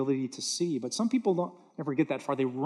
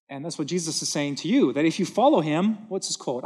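ordinary delivery

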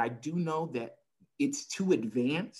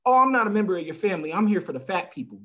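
higher, louder, fast mock protest; father refusing the cancer brochure, higher, louder and fast; indignant father; 6 semitones higher, 7 dB louder, faster than usual. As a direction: brush it off like he's insulted. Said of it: "and I quote".

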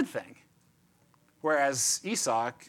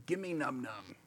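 low-key, quiet mock caveman; softly acted caveman begging; caveman baby-talk; 3 semitones lower, 10 dB quieter than usual. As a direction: mumble for food like a simple brute. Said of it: "sort of like caveman language".

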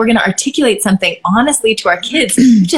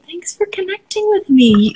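much higher, slow soothing parent voice; gentle parent; 10 semitones higher, slower than usual. Shown as a demonstration. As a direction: thank the child tenderly and slowly. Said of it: "I would just say".

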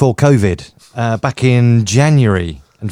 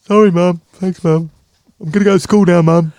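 high, put-on schoolboy voice; mock-obedient child, pitched up; chastened son; 7 semitones higher, 4 dB louder than usual. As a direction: answer Mum in a raised, louder little-son voice. Answers the acted mum as son.

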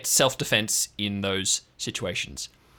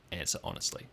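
whispered; 5 semitones lower, 12 dB quieter, faster than usual. Acted. Gina ends quiet.

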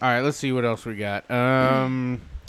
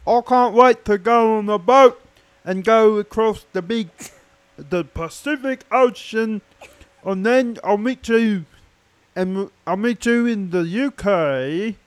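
hammed-up British accent; 7 semitones higher than usual. Host promises a British bride a visit, hammed-up British accent. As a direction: put on an exaggerated, high British accent. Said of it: "I'll do it in my best British accent".

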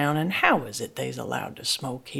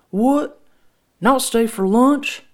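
surprised; 11 semitones higher, 11 dB louder, slower than usual. A narrator's reading. Daddy surprised they leave.

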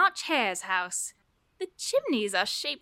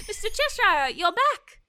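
high, louder, laughing with relief; delighted laugh; 7 semitones higher, 5 dB louder than usual. Acted. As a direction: greet him with a happy laugh. Laughs as the cat reappears.

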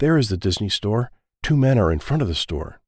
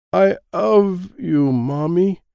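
slow tender; 9 semitones higher, 4 dB louder, much slower than usual. Acted. Child's first words to mom.

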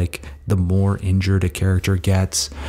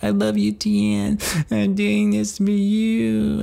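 put-on much higher, slow, childlike devotion; baby voice; slower than usual. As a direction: coo it like a tiny devoted doll. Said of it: "He's got, like, a baby voice".

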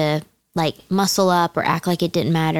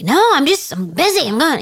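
sharp defensive snap, starting much higher and louder; defensive snapping; 8 semitones higher, 4 dB louder than usual. Retold or imitated. Wife snapping back at her husband, defensive. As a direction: brush him off, busy and short. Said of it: "You're snapping at me".

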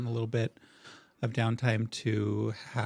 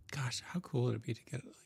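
whisper; 8 dB quieter than usual. Real, not acted. Unvoiced whisper aside.

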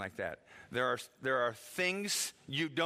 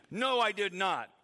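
loud, higher, emphatic denial; indignant, punched-out retort; exaggerated retelling; 6 semitones higher, 6 dB louder than usual. Shown as a demonstration. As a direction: deny it forcefully, overstating the tone. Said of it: "maybe they said it in a really soft tone".

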